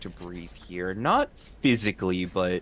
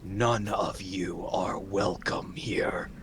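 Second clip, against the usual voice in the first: undead whisper. Acted. Mummy warns party away.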